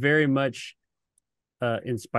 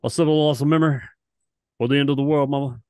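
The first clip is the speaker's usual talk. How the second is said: loud mock [name] croon; [name] impression; 3 semitones higher, 7 dB louder, faster than usual. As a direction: croon it like [name] ending on mama. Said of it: "you sounded like [name]".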